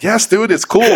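high-pitched, insistent agreement; retold insistent reassurance. Recounting his reply about apologizing, insistent reassurance. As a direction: re-enact brushing off a worry.

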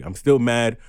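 ordinary talk

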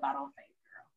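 fast whisper, no voice; whispered aside. Real, not acted. Telling the cohost Darla's advice, a quick whisper. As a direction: hurry through it in a whisper. Sudden aside while recapping scene.

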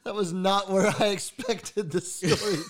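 high, laughing disbelief. Real, not acted. Listener laughing in shock at the story.

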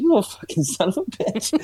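playful fake fury; mock outrage. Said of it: "I was like".

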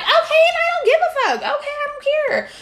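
very high, loud put-on voice of careless indifference; hammed-up high "I don't care" voice; mock dismissive. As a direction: squeal the dismissal like a caricature. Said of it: "be across the room and be like".

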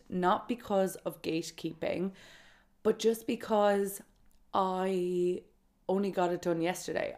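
ordinary talk, nothing marked

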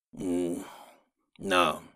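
soft, low refusal. Acted. Don Bernardo refusing Alfredo, in a low voice. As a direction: say it quietly, withdrawn. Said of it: "en voz baja".

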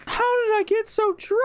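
high-pitched imitated drunken wail; hammed-up tearful drunk; mock crying. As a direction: whine it like a weepy drunk.

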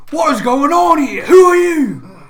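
loud, high demand; angry shout. Acted. Talazar confronting Jack after the punch, shouting demand. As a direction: yell at the stranger who just killed an orc. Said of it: "And she turns and says".

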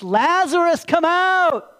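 high, booming command; loud cry. Acted. Jesus calls the dead man out of the tomb, loud cry. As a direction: call out with full force to someone far off. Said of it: "he cried out with a loud voice".

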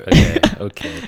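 giggling through it; laughing. Real, not acted. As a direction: laugh mid-word. Laughing at nickname.

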